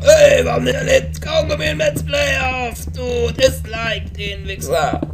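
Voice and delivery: high ranting internet hater; angry viewer. Retold or imitated. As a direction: rant like an annoyed commenter. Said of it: "Und denkst einfach".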